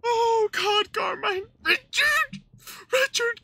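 high, loud cries of despair; anguished wail. Acted. Player cries out to Carmine over the tape, anguished wail. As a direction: wail the name in mock anguish.

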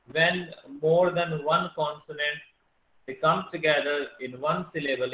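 slowed right down for note-taking; steady dictating pace; slow dictation. Shown as a demonstration. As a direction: dictate the rule word by word. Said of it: "dictating definition".

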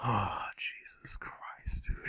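slow, breathy whispered sigh; exasperated whisper. Real, not acted. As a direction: whisper it in defeat. Muttering before retry.

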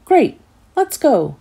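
jolly Greedy cheering, let's go; bright eager cheer. Acted. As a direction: sell it like the best idea all day.

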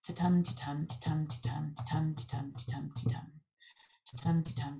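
weak "ta", stressed "tum", evenly repeated; steady iambic rhythm. Shown as a demonstration. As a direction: beat out ta-tum like train tracks. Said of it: "That's the rhythm I'm hearing".

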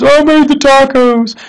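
a hint of a mother admitting it; mother answers. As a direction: drag it out like a sheepish mother. Mom voiced in story.

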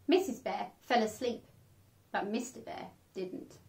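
usual speaking voice

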